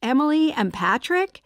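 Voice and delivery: high, loud chirped question; chirpy. A narrator's reading. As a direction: chirp it with bright surprise. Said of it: "Amy chirped".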